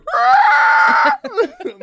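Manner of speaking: high screaming cackle; shrieking laugh. Real, not acted. Hysterical laughter at velociraptor joke.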